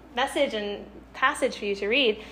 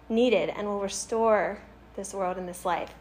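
ordinary talk

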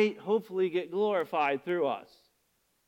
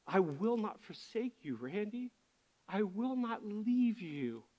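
tender voice of God; 3 semitones lower, 5 dB quieter than usual. Pretend. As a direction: speak as a loving father comforting his son. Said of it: "him saying".

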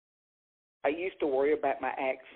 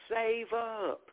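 retold yell; 8 semitones higher than usual. Lady at the back of the club heckling, retold mock yell. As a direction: imitate a heckler's yell without the volume. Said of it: "lady in the back of the room yelled".

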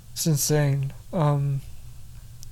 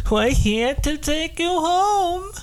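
high put-on voice of an abductor; alien abductor voice; 10 semitones higher than usual. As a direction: play the captor come to take him. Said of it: "He's like".

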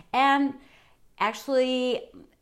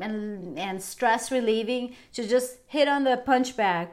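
ordinary talk, nothing marked

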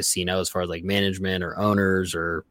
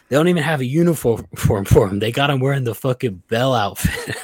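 higher put-on voice breaking into a laugh; imitating a coworker; 5 semitones higher than usual. Coworker describing the new guy's uniform, mock voice with a laugh.

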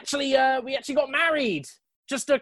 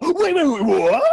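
shocked; 3 semitones higher, 6 dB louder than usual. Acted. Learns wife is in burning bar.

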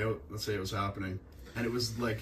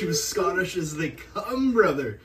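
laughing; 7 semitones higher, 7 dB louder than usual. Real, not acted. Laughing, starting Scottish voice.

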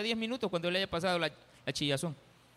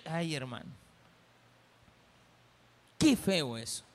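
usual speaking voice

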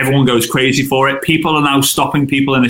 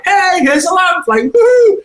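shrieking high and loud with delight; high excited woohoo; 3 dB louder than usual. Retold or imitated. Group member cheering her own lamp post, high excited squeal. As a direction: squeal it like a thrilled fan.

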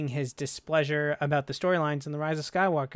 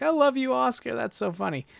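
imitating a tickled interviewer, high-pitched then falling; lightly mock-laughing praise, pitched way up; amused gushing; 9 semitones higher than usual. Interviewer tickled by Oscar's honest answer, amused gushing. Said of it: "was kinda laughing, saying, like".

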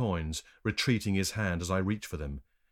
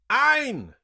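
very high, loud shout; one ringing, high yell; shouted count; 10 dB louder than usual. A narrator's reading. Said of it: "shouted the man who was keeping count".

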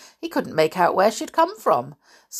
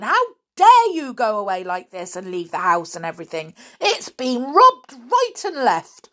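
loud, high outraged scolding; amazed and angry; 7 semitones higher, 7 dB louder than usual. A narrator's reading. Father rounds on George at the police station, angry. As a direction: burst out at the runaway child. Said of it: "still looking amazed and angry".

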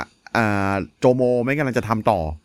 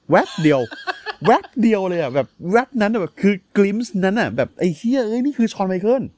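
excited laughing; 6 semitones higher than usual. Real, not acted. Excited about a Shawn Michaels glimpse.

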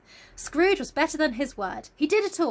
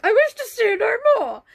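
high, louder melodramatic wail; mock tragic Scrooge; 8 semitones higher, 7 dB louder than usual. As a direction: ham up the sorrowful miser. Sad film Scrooge quoted.

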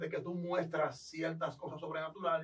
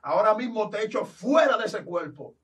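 loud, forceful exorcist command; preacher casting out a demon; commanding rebuke; 4 semitones higher, 6 dB louder than usual. Retold or imitated. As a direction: order the demon out with full authority. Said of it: "le dije".

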